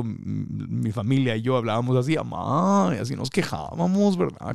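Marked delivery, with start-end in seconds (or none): 2.2-2.9 Tijuana "amá". Retold or imitated. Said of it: "Con el acento".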